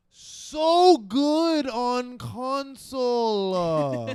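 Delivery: drawn-out praise. Real, not acted.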